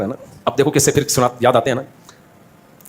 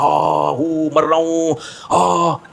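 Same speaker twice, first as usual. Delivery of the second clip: slow, moaning fake-injured man; mock dying groan; much slower than usual. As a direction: ham up a dying moan. Faking injured man moans.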